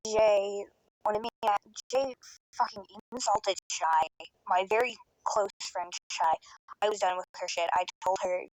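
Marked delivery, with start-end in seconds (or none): none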